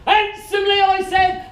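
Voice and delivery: very high, loud, angry repeat; exasperated yell. Retold or imitated. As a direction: lose patience and roar it. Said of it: "and he says".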